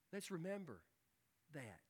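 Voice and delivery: ordinary talk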